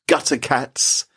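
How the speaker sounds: normal talking voice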